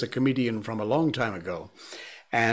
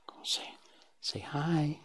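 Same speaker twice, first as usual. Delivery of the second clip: whispering; 3 semitones higher, 8 dB quieter than usual. Real, not acted. Whispering to coax dog.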